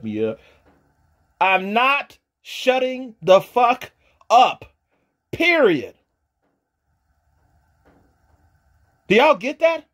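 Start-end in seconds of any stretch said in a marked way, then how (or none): 1.4-9.9 higher, louder, slow and furious; real furious defiance; defiant shout. Real, not acted. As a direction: hammer every word at the haters. Defiant rant at critics.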